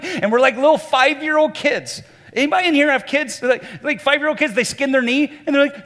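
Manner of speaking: loud, high-pitched preacher in full flow; full-force preaching. Real, not acted. Preacher illustrating impatient drivers to a congregation, loud impassioned preaching. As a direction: drive the illustration home at the top of your voice.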